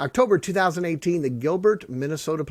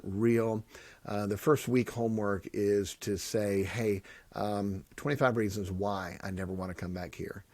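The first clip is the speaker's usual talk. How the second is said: softer, lower sincere talk; sincere, heartfelt; 5 semitones lower, 7 dB quieter than usual. Real, not acted. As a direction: speak gently from the heart about inmates. Heartfelt jail ministry talk.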